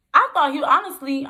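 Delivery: high, loud burst; emphatic aside. Real, not acted. Telling viewers of her surprise, loud and high. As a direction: stress the surprise.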